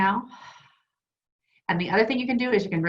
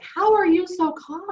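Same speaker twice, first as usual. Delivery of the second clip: high, drawn-out incredulous question; freaking out; 10 semitones higher, 3 dB louder than usual. Acted. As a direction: play someone losing it, baffled by the other's calm. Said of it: "somebody's freaking out in the movie".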